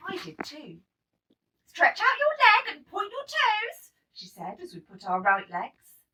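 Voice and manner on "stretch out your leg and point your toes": high, loud teacher's command; teacher's instruction. A narrator's reading. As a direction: call it across the studio. Ballet teacher instructing class.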